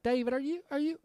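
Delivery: high-pitched, worried questioning; alarmed woman imitation. Imitates alarmed woman checking on David.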